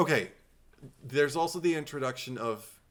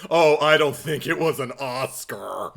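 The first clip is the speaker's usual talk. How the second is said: louder, hammed-up breathy starlet voice; Jennifer Tilly impression; 2 semitones higher, 8 dB louder than usual. Jennifer Tilly answers Don about the Oscar, in a hammed-up impression. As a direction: play the actress brushing off the award, overdone. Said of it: "my horrible impression of Jennifer Tilly".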